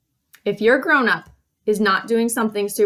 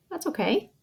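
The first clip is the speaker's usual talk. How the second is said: ordinary talk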